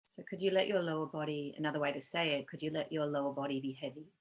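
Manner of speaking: calm, unhurried meditation guide with long pauses; guided meditation. Real, not acted. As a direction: gently lead them into body awareness, leaving space between phrases.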